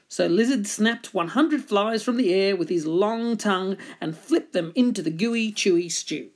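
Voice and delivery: usual speaking voice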